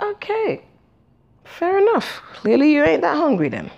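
high, mocking sarcasm; sarcastic. Sarcastic retort to friend.

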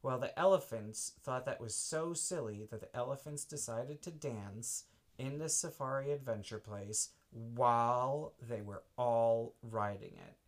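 usual speaking voice

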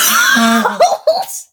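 high loud burst of laughter; real giggling fit; laughing fit. Real, not acted. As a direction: crack up at the silly song. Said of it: "this got me cackling".

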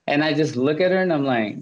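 ordinary talk, nothing marked

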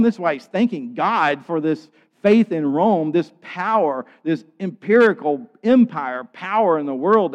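loud, high-pitched sermon delivery; impassioned preaching. Real, not acted. Preacher proclaiming the gospel's power over Rome, impassioned. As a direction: proclaim it boldly at full force.

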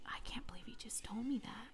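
lying in bed, whispering in disbelief; whispered disbelief. Retold or imitated. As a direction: whisper it so nobody hears. Laughing to herself in bed.

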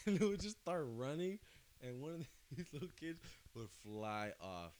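laughing through the words. Real, not acted. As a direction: giggle while telling it. Laughing while telling the sheep story.

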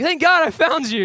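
high, loud burst of relief; relieved outburst. Retold or imitated. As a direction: blurt it out like a lost kid who just found his mom.

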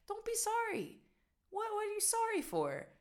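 high, disbelieving pitch leap; incredulous. Real, not acted. Vlogger baffled at Dutch people apologizing, high incredulous. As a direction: squeak out disbelief that they apologize.

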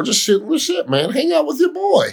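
high sing-song pleading; teasing high voice. Acted. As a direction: whine it up high playfully. Teasing a friend.

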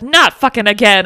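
loud, exasperated retold outburst; exasperated exclamation. Fed-up woman retold as she runs out of there, exasperated exclamation. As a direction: burst out loud, acting her refusal. Acted quote of fleeing woman.

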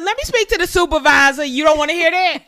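loud, high, entitled "let me speak to the supervisor" voice; pushy high-pitched complaint; demanding customer voice. Pretend. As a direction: play the customer demanding a manager. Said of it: "this tone gonna get your ass".

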